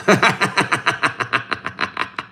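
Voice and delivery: a high, bursting real laugh. Real, not acted. Streamer laughing at a chat comment, a high burst of laughter.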